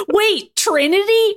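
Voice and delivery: high, drawn-out excited question. A narrator's reading. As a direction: squeal it with excited disbelief. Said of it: "Ryan said excitedly".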